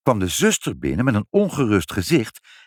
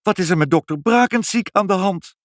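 high-pitched dismayed question; upset; 8 semitones higher than usual. A narrator's reading. Nurse asks what ails the doctor, upset. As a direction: ask in startled distress. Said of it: "riep de zuster ontdaan".

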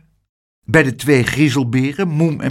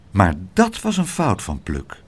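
ordinary delivery

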